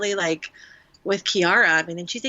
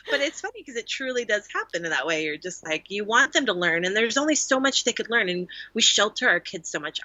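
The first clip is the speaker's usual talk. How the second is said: normal talking voice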